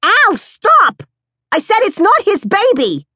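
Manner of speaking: very high, loud scream of stop; screaming in his ear. Acted. Mother screaming into her husband's ear to stop him. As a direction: shriek right in his ear. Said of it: "Moms screams in his ear".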